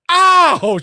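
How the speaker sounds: high, loud mock yelp of pain; retold yelp. Husband imitating wife yelping when her head hit the window. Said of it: "She starts yelling".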